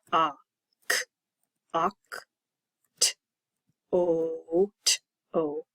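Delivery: one isolated sound at a time, pauses between; sounding out October. Shown as a demonstration. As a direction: segment OCTOBER sound by sound, slowly. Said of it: "count the sounds".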